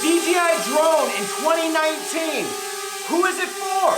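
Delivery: high, loud announcer hype; hype intro voice. Acted. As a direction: pump up the opening like a trailer. Staged hype intro for drone video.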